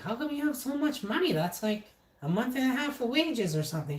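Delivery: high-pitched questioning mother voice; imitated amazed mom. Mom sees son's cashed check, high incredulous voice.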